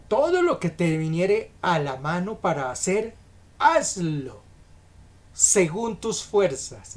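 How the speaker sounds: slow, forceful Bible verse; emphatic scripture reading. Acted. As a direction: proclaim the verse phrase by phrase, with pauses. Reads scripture aloud to congregation.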